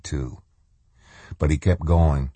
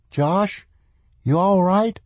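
put-on high child voice, worried; girl voice; 14 semitones higher than usual. A narrator's reading. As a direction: voice young Swan, high and concerned. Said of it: "Swan asked".

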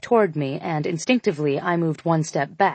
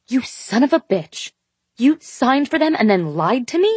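angry; 6 semitones higher than usual. A narrator's reading. Angry woman confronts narrator.

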